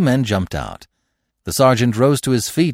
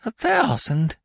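dazed; 11 semitones higher, slower than usual. A narrator's reading. Prisoner repeats the reward sum, dazed. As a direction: let it sink in, dazed. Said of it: "he muttered in a dazed fashion".

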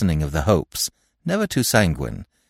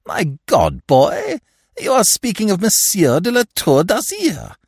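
outraged; 13 semitones higher, 4 dB louder than usual. A narrator's reading. Outraged godfather protesting.

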